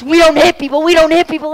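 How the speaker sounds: high, loud scolding scream; screaming mom. Retold or imitated. Mother screams at her child after the punch, furious. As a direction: scream the rule into the child's face. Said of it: "she started screaming in my face".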